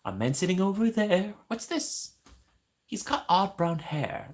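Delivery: high, wondering character voice; high excited mock Jack; imitating Jack Skellington. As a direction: gasp at everything like Jack. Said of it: "he'd be like".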